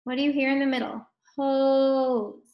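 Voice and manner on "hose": "Hose" with a long, held o; slow sounding-out. Shown as a demonstration. Teacher asks what is heard in the middle, slow "Hose". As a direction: draw out the vowel in "Hose". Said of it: "Say it slow".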